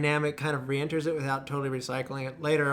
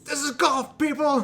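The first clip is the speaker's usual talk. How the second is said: mock yell; 11 semitones higher, 5 dB louder than usual. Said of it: "he's like".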